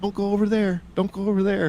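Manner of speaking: high urgent plea; willing her away from the corner; pleading whisper-thought. Retold or imitated. Kid silently begging mom, high and urgent. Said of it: "going like".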